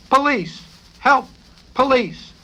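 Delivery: high, loud panicked screams; screaming for police. A narrator's reading. Man thrown into ambulance, screaming loud for police. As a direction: cry out in terror as you're dragged off. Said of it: "he shrieked in a voice strangling".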